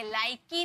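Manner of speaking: लाइकी ending in a clear ee; extra final syllable on लाइकी; wrongly sounded final e. Shown as a demonstration. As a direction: mispronounce it लाइकी, "like-ee". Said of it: "लाइकी नहीं".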